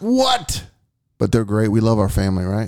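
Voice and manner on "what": high incredulous "what?"; surprised. Real, not acted. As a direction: jump up in disbelief. Reacts to "called me a weirdo.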